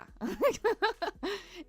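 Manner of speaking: high, bright giggle; a quick embarrassed giggle. Real, not acted. Laughing at her mix-up with a viewer, giggling.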